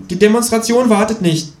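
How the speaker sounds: high-pitched, firm creature voice; insistent kangaroo. Acted. Kangaroo urging narrator to the demonstration, high and insistent. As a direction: press on in a high voice.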